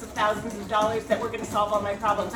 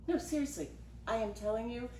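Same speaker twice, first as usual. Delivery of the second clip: usual speaking voice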